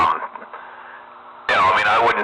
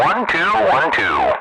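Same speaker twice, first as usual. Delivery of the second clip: mic check count; slower than usual. Shown as a demonstration. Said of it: "Do that again".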